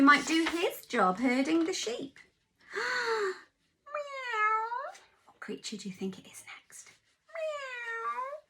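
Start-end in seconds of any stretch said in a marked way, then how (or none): 2.7-4.9 high, soft rising meow; playful kitten meow. Acted. Story turns to the cat. 5.3-6.9 fast, hushed teasing question; secretive whispered guess. Acted. 7.3-8.4 high, soft rising meow; playful kitten meow. Acted. Cat sound to children.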